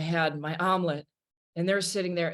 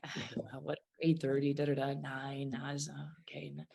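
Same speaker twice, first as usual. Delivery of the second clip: half-voiced mutter, trailing away; disappointed mutter; 3 semitones lower, 9 dB quieter than usual. Waiting singer mutters to herself, disappointed. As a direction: mumble the letdown under your breath.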